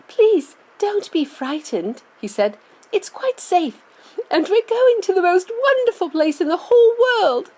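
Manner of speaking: put-on high, soothing child's voice; boy voice. A narrator's reading. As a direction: reassure the old folks gently. Charlie comforting grandparents.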